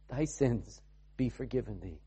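hushed gentle forgiveness; tender Jesus. Acted.

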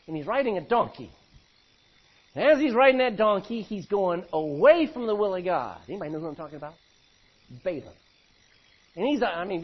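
ordinary talk, nothing marked

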